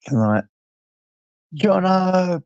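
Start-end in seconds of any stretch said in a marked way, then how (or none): 1.5-2.4 sings the name out high and bright; a glad shout-out to a regular; excited greeting. Real, not acted. Greeting a regular viewer who just joined, excited call-out.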